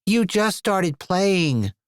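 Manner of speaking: put-on slow, high whining boy; whiny kid. A narrator's reading. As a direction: drag it out in a whine. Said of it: "in his own whiny voice".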